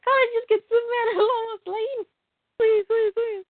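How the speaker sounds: put-on high pleading child voice; whiny kid begging. Kid begging for a different Happy Meal toy, high and whiny. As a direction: beg like a kid at the counter.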